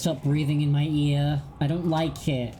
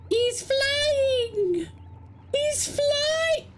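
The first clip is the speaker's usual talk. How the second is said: squealed, much higher, in a different voice; shrieking delight; much slower than usual. Real, not acted. Player squeals as the character goes flying, excited. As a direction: shriek with glee.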